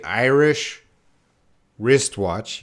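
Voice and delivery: IRISH, a gap, then WRISTWATCH; slowly, pausing between Irish and wristwatch; slow and separated. Shown as a demonstration. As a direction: say Irish and wristwatch as two careful, separate words. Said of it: "you can't say".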